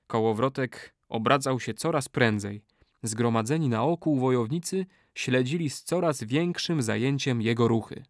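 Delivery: ordinary delivery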